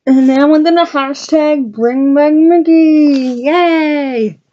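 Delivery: high, slow, gleeful cheer; excited cheer. Real, not acted. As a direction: celebrate it, drawn out and bright. Cheering for Mickey.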